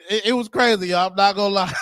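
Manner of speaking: ordinary talk, nothing marked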